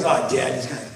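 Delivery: low, soft, dismissive mumble; bored teen son. Retold or imitated. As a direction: shrug it off like an unimpressed kid.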